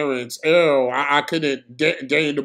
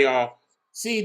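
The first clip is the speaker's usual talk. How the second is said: usual speaking voice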